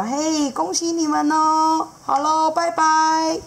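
high, slow congratulations; drawn-out friendly goodbye; cheerful seahorse dad. Acted. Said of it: "海马爸爸就说".

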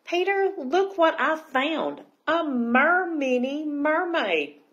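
high, slow, drawn-out showing off; excited announcement. Acted. Girl showing her brother the mermini, excited announcement. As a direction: call out the find with wonder.

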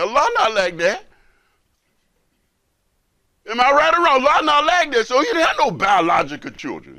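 loud, rising fiery sermon voice; preaching. Acted. Imam preaching to brothers and sisters, loud and fervent. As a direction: preach it out to the whole room.

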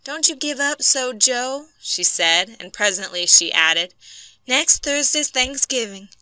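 wife comforting her husband before Thanksgiving; pleading wife. A narrator's reading. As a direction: coax Joe not to give up.

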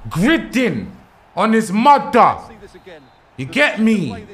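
loud high hype after scoring; excited celebration. Real, not acted. Gamer celebrating his goal to viewers, excited.

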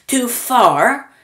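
high, loud incredulous cry; cried. Acted. Mr Wonka crying back at Charlie, high and loud. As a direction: cry it out, scoffing at the question. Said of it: "cried Mister Wonka".

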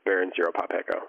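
ordinary delivery

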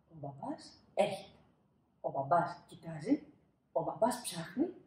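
ordinary delivery, nothing marked

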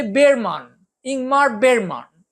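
"বেরমান" slow and careful, without the g; Bergman pronunciation. Shown as a demonstration. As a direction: spell out ইংমার বেরমান slowly, with the g dropped. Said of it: "তার পদবীর উচ্চারণ আসলে".

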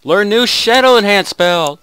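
ordinary delivery

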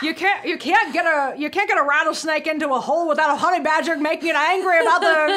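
loud, high folksy Southern rant; hammed-up Louisiana accent. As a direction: crank up a cartoon Louisiana holler. Riffing as a folksy Louisiana strategist.

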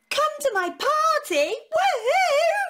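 high cheering invite; joyful party call; excited. Acted.

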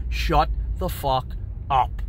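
slow, word-by-word command; firm order. Retold or imitated. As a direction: space out each word with authority, no shouting. Retells his order, word by word.

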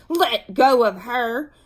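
slow, loud command; forceful protective demand; angry. A narrator's reading. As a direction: order it angrily, each word drawn out. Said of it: "said Beezus angrily".

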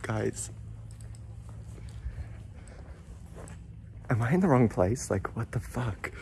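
hushed, half-whispered; whispering on the phone. Real, not acted. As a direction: keep your voice down in an empty place. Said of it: "I feel like I have to whisper".

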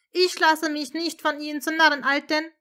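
loud, high, furious near-scream; almost yell it in rage; near shout. Acted. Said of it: "schrie er nun fast".

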